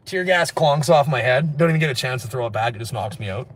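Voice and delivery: ordinary talk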